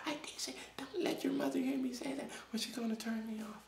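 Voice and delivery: hushed whisper so mom won't hear; guilty whispered aside. Real, not acted. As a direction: whisper it like a secret.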